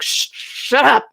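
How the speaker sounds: slow, drawn-out irritated shush; annoyed shush. Retold or imitated. Shushing the noisy boys.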